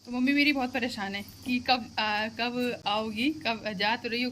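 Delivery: normal talking voice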